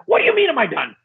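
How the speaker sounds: high-pitched mock indignation; acted-out incredulous comeback; indignant retort. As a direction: throw it back in disbelief, pitch jumping up. Retold indignant retort to wife.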